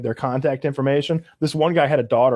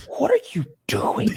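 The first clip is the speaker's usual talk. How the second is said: high, breath-only stage whisper; incredulous whisper. Acted. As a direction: whisper it in hushed disbelief. Guard asking in disbelief, acted out.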